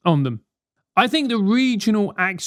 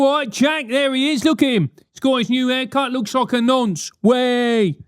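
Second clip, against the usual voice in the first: cheeky London banter shout; mock London banter; 6 semitones higher than usual. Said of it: "We go".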